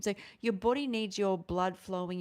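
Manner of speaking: ordinary talk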